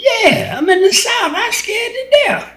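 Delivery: laughing at his own terror from back then; excited retelling. Real, not acted. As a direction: let the voice jump up high as you relive the scare. Recalling his fear in the South.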